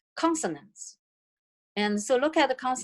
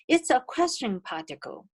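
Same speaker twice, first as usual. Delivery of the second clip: normal talking voice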